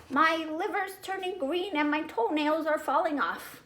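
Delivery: high, lively little kid playing a puppet; a small boy hamming up his character. A narrator's reading. Fudge playing Bert at dinner.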